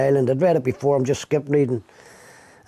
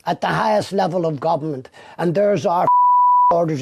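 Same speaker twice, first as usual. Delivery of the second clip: menacing detective; 6 semitones higher, 4 dB louder than usual. Retold or imitated. Retelling a detective's threat.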